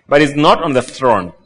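ordinary talk, nothing marked

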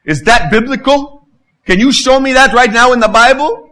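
pushy, self-righteous challenge; confrontational challenge. Shown as a demonstration. Pastor mocking a self-righteous critic, loud and demanding. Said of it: "That's a different attitude".

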